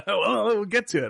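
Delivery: laughing. Real, not acted. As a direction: chuckle through the words. Laughing off the disagreement.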